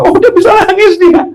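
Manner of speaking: very high, gleeful exclamation; imitated proud, amused parent; delighted parent. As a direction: coo in high-pitched amazement at the baby. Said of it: "Orang tua kita tambah ketawa-ketawa".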